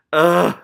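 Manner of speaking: a sudden loud ugh of dismay; loud disgusted groan. Real, not acted. Reader groaning loudly at the rat's fate.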